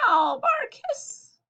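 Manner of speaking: squeaky pet noises ending in a hiss; cat hiss at the end; high animal noises; high meow, bark and hiss. Acted.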